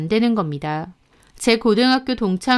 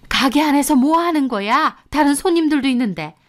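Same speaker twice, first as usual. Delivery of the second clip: put-on higher-pitched, reproachful older relative; scolding mother-in-law; 5 semitones higher than usual. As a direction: scold them for making a scene. (나무라는 시어머니 목소리)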